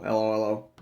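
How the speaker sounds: usual speaking voice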